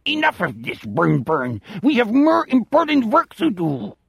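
high, stern alien-German voice; weird alien accent. A narrator's reading. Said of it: "switches to the weird accent".

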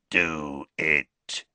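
a touch of a rough, barking werewolf voice; rasping bark. Acted. Greyback urging the kill, a rasping bark. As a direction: growl it out like a brute. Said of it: "a rasping bark of a voice".

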